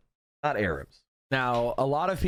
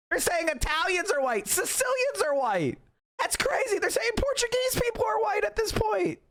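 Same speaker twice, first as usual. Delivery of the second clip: escalating disbelief; 12 semitones higher than usual. Acted. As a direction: pitch climbing higher and higher with each example. Escalating incredulous rant about whiteness.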